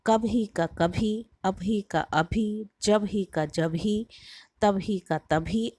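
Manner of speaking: "कभी का कभी" said slowly, each word set apart for the class; slow pronunciation demo. Shown as a demonstration. Teacher to students, slowly demonstrating how speech changes. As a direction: spell out "अभी", "जब ही", "तभी" slowly, word by word. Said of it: "बोल दिया जाता है".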